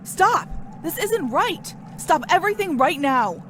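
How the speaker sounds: yelling to halt the shoot; shouting. Real, not acted. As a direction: shout to stop everyone. Bursts into the shot.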